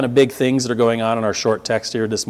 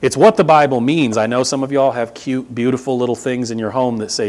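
normal talking voice

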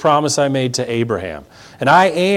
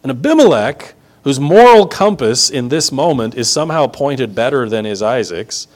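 normal talking voice

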